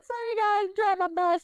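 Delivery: high-pitched apologetic yell across the shop; high mock yell. Cashier yelling sorry to the waiting line, high-pitched. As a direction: call it out high and bright, half laughing. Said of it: "I yelled".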